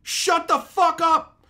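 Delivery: loud, high shout at the screen; yelling. Retold or imitated. Viewer yelling at a terrible movie on TV, furious reenacted shout. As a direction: bellow it out in exasperation. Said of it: "I literally yelled at the T V".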